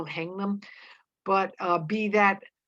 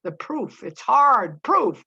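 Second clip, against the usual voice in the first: exasperated, higher and louder on the hard proof; great impatience; 4 semitones higher, 7 dB louder, slower than usual. A narrator's reading. Mary Warren tells Proctor of the proof, with great impatience. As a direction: press each point impatiently, stretching the opening. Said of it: "with great impatience at him".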